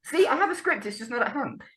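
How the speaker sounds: high-pitched comic self-defence; defensive protest. Real, not acted. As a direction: argue back, voice pushed up high. Defends himself against co-host's teasing.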